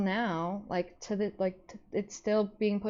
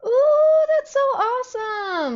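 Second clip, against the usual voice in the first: big high gasp, then a much louder, higher ooh; thrilled for them, burst up high; excited gasp and squeal; 9 dB louder, slower than usual. Real, not acted. Streamer reacting to a viewer's happy news, squealing with excitement.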